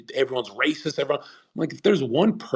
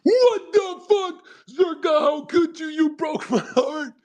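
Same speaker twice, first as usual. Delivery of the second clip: much higher and louder, wailing and slow; mock heartbreak; 4 dB louder, slower than usual. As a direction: whine like a betrayed fan. Heartbroken Albanian fan, mocked.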